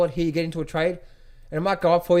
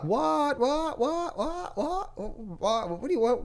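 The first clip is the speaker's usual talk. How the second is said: high, soft incredulous stammer; mocking gym mate; 8 semitones higher, 5 dB quieter, much slower than usual. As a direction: squeak out baffled whats at him. Gym mates mocking him.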